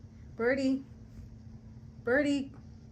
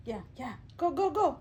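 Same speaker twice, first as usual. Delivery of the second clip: ordinary delivery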